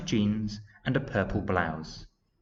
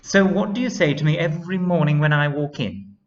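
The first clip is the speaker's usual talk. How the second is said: lively teacher voice; 7 semitones higher, 8 dB louder, faster than usual. Acted. Miss Wiz voiced.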